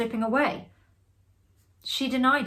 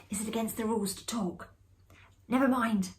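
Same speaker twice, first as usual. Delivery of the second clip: hushed whisper in the dark; 3 semitones higher, 3 dB quieter than usual. A narrator's reading. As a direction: whisper so nobody hears. Whispered question in hiding game.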